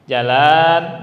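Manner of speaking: Jalan with a drawn-out j and first a; loud Jalan, stretched at the start; long jim. Shown as a demonstration. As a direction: lengthen the opening ja of Jalan. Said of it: "Antum mau baca jimnya panjang, boleh".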